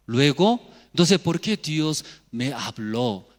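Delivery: normal talking voice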